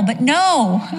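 normal talking voice

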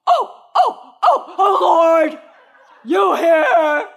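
very high, loud wailing prayer; hammed-up wail to the Lord; dramatic praying mom. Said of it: "it was that dramatic".

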